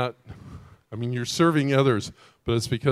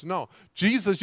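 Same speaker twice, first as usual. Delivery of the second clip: normal talking voice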